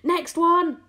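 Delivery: high, loud call; shout. A narrator's reading. James calling for the next seagull, shouting. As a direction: call briskly for the next, well up. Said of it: "he shouted".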